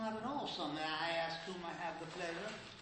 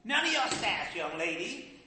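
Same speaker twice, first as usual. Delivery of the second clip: mother cutting off her daughter's sass, loud; indignant snap; 8 dB louder than usual. Acted.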